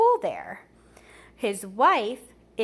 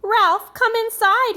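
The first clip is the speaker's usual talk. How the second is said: mother calling her son in, higher and louder; 11 semitones higher, 8 dB louder than usual. Acted. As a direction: call him in from the yard. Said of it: "his mother called".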